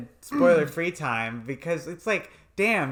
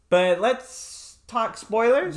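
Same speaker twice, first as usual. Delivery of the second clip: sing-song announcement; 10 semitones higher, 5 dB louder, slower than usual. Acted. Announcing spoiler section playfully.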